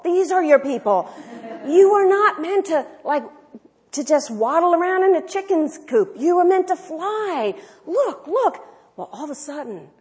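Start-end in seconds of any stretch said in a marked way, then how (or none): 0.0-1.1 louder, laughing repeat; excited. Retold or imitated. 1.7-8.6 higher, louder coaxing voice; eager teaching. Retold or imitated. Friend teaching eaglet, quoted.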